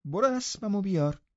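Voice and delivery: put-on high, imperious order to a stable boy; haughty little mistress giving orders; bossy command. A narrator's reading. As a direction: order them about like a servant. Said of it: "این را طوری گفت که انگار او هم یکی از پادوهای استبل".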